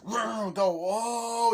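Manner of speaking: loud, high revving and whooping; mock race-start excitement. Karts setting off, loud high excited whoop. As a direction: rev up, then whoop.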